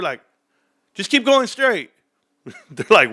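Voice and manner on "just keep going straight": put-on high kid voice directing. Kids guiding cops from the back seat, high confident voice.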